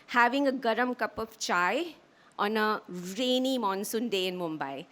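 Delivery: usual speaking voice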